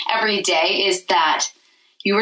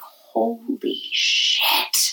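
awed whisper; 3 semitones higher, 3 dB quieter, much slower than usual. Retold or imitated. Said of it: "And you're like".

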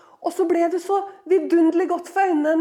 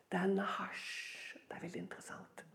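hushed whisper; 7 semitones lower, 17 dB quieter than usual. Acted. Teaching the Hebrew word.

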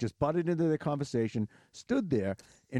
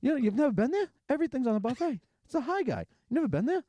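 very high, eager greeter voice; pushy greeter; 14 semitones higher than usual. Retold or imitated. Greeter badgering the kids about the buffet, very high. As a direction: oversell it, way up high.

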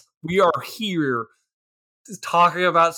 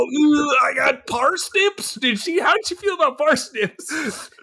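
mock eager; 12 semitones higher, 3 dB louder than usual. Cook eagerly offers more food.

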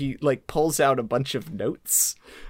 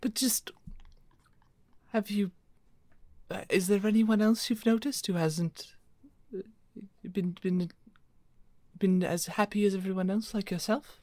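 Lady Dave's voice; 6 semitones higher than usual. Acted. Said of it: "supposed to still be doing Lady Dave's voice".

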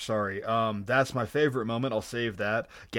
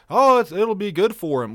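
lightly voiced hard-headed dad, higher and louder; mock dismissive dad voice; Hank; 6 semitones higher, 6 dB louder than usual. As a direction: raise it into a brief stubborn-dad imitation. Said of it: "this isn't hard-headed Hank with".

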